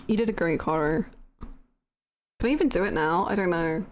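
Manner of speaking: normal talking voice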